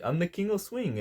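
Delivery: brash showman swagger, imitated; energetic imitation. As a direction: ham up a cocky party guy. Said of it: "he said it with such, like, uh, energy".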